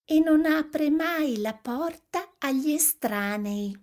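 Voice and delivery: put-on high-pitched child's voice; innocent little girl. A narrator's reading. Little Red telling the wolf about grandmother, girlish voice. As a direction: explain it like a trusting small girl.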